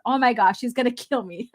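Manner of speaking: usual speaking voice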